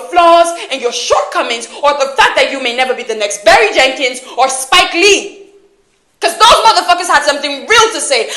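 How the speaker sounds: loud, impassioned dressing-down; furious accusation. Acted. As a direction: berate them at full volume, pitch climbing. Acted quarrel, furious accusation.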